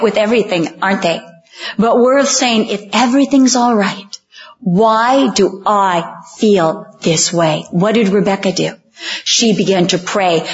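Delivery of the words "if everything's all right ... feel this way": measured plea, stretching "why do I feel this way"; deliberate questioning. Retold or imitated. Question repeated as the congregation's own.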